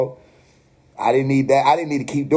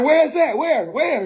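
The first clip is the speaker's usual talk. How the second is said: high frantic mock scream; panicked screaming; 10 semitones higher, slower than usual. Storyteller freaks out at the ceiling, high and frantic. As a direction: yelp it, looking around in panic. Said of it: "I'm screaming".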